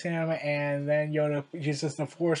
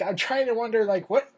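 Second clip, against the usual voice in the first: ordinary delivery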